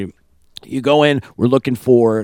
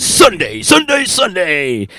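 slow, raised rally-ad chant; monster truck announcer; 4 semitones higher, 4 dB louder, slower than usual. Acted. Host repeating the event day, monster truck voice. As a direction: stretch each one like a truck-show promo. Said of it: "that was my monster truck voice".

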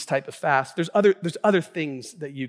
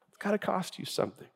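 much softer, quicker, understated; hushed repeat; 3 semitones higher, 8 dB quieter, faster than usual. Real, not acted. Pastor letting his point sink in, hushed repeat. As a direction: drop the volume right down on "It's gotta cost you something".

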